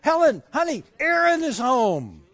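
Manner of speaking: high, excited call upstairs; excited yell. Retold or imitated. Husband calling his wife upstairs about their daughter, excited yell. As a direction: call out the happy news. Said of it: "I yelled to my wife who was upstairs".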